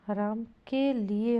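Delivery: राम के लिए slowly, word by word; separated words. Shown as a demonstration. Teacher giving the accepted form, slow and separated. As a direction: set के and लिए apart. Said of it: "होगा".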